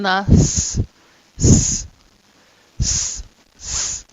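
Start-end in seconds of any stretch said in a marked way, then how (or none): 2.8-4.0 unvoiced hissed S, no vowel; pure hissing S; hissed S sound. Shown as a demonstration. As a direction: hiss the S alone. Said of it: "The same sound".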